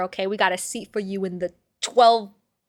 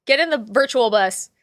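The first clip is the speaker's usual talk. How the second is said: ordinary delivery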